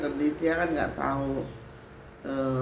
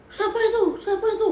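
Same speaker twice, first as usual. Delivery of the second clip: high, loud panicked cry of who's there; a scared woman crying out, much higher; possessed woman asking fearfully who is there, high and loud; frightened; 7 dB louder than usual. Retold or imitated. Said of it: "dia ketakutan".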